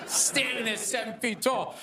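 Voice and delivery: ordinary delivery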